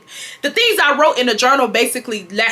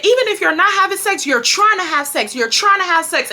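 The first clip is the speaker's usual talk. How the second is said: scolding mom; 8 semitones higher than usual. Retold or imitated. Retold mother scolding in quarrel.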